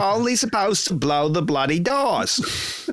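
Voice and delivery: high, drawn-out Cockney movie-quote impression; slow, exaggerated Cockney; Cockney accent. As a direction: stretch it out in a put-on Cockney accent. Quotes film in Michael Caine voice.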